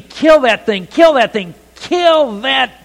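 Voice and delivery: imitating a panicked scream. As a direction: shriek it like she's up on a chair.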